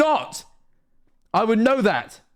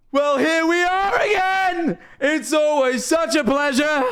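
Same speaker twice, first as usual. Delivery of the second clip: very high, drawn-out mock greeting; slow, high-pitched theatrical quote; put-on character voice; 14 semitones higher, slower than usual. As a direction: sing out the words in a high, stretched voice. Quoting a line in put-on voice.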